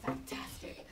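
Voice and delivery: plain reading voice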